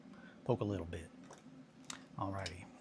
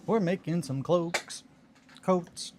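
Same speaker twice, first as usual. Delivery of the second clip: playful announcement; 9 semitones higher, 5 dB louder, slower than usual. Pretend. Playful announcement while crafting.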